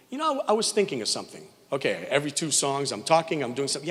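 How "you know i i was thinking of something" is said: imitating Sheldon.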